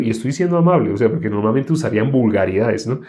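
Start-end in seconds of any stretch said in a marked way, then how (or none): none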